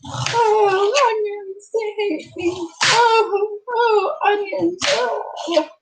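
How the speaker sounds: high, teary whimper over the onions, crying from the fumes; a real cry pulled out by stinging onions; onion tears. Real, not acted. Cooking vlogger chopping onions, eyes watering, crying high. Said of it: "I'm about to start crying".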